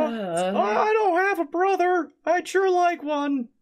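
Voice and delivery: very high wistful goblin voice; squeaky and a little sad. Acted. Goblin Prince voice.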